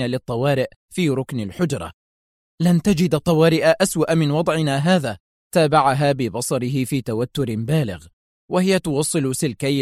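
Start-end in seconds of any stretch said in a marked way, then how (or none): none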